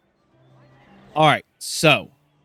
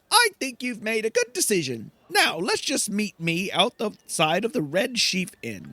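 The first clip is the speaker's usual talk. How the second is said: higher, eager little servant; pleased with the deal, pitched up; Garrick; 8 semitones higher than usual. Acted. Garrick approving the player's choice, higher servant voice. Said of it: "He then says".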